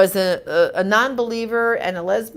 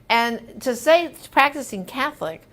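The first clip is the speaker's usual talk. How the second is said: ordinary delivery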